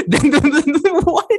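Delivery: high, loud laughing outburst; laughing disbelief. Real, not acted. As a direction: laugh through the words.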